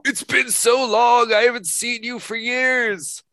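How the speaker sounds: put-on high, slow elderly voice; old friend reuniting. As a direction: drag it out, an old man greeting a long-lost friend.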